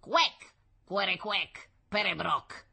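lightly odd gibberish muttering; short bursts of quacking nonsense; muttered strange language. A narrator's reading. Stranger talking his odd language to himself, muttering. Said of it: "lo sentiva borbottare Paolo nella sua strana lingua".

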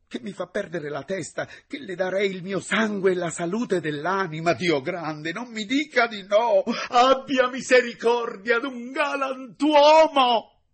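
high, loud, slowing into a begging cry; desperate plea. Acted. Secretary begs the teacher not to refuse him, desperate high cry. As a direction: beg for mercy at the peak of passion. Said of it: "tanto calore di passione".

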